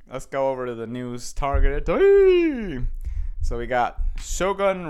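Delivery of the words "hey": sudden loud, very high "hey"; high jingle-like whoop. Pretend. Host launching the news segment, playful high whoop. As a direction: squeal out a held, high-pitched sting. Sound after announcing targeted news.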